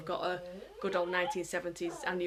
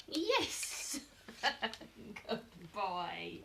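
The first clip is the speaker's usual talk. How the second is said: excited yes; 4 dB quieter, much slower than usual. Real, not acted. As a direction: cheer it. Dog succeeds again.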